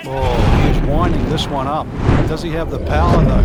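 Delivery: rising excitement, loud and fast; excited call. Real, not acted. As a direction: call the big drive with real excitement. Big drive being struck.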